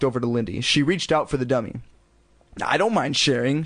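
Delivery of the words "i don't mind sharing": usual speaking voice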